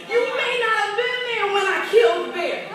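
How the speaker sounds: loud, rising preacher's cry of faith; preaching. Acted. Preacher boasting as David to the congregation, loud. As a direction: preach it with full force, building.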